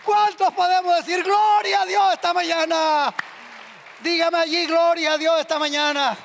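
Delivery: loud, high preacher's shout; rally the congregation to shout praise; full-voiced, exultant call for a response; rousing call. Real, not acted. Rousing congregation to give glory.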